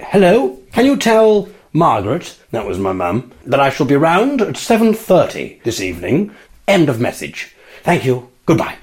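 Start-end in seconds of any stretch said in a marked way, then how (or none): 0.0-2.3 high-pitched, over-formal telephone voice; posh nana. Retold or imitated. Nana leaving mum a message, putting on posh. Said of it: "Nana would get a lot more posh". 3.5-8.8 stiff, formal message dictation; posh nana. Retold or imitated. Nana signing off to an answering machine, stiffly posh. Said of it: "Nana would get a lot more posh".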